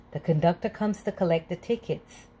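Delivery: plain reading voice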